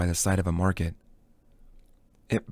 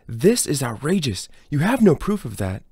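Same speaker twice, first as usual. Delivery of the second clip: louder, higher outraged protest; 5 semitones higher, 4 dB louder than usual. Acted. Accused scythe protesting to Rowan, loud and outraged. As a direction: protest hotly, rising in pitch and volume.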